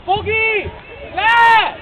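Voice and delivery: high, loud one-word yells; urgent shout. Real, not acted. Coach ordering Fogi back during play, shouting. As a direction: yell each word across the pitch.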